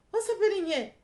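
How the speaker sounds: high-pitched startled question. Retold or imitated. Woman to the man acting strangely, taken aback. Acted shocked reaction to his coldness.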